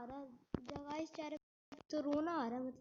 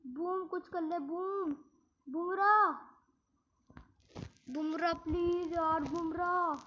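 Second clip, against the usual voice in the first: pleading cheer; 2 semitones higher, 6 dB louder than usual. Real, not acted. Begging teammate Bumrah to clutch.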